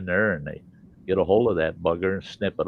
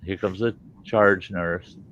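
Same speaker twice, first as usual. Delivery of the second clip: normal talking voice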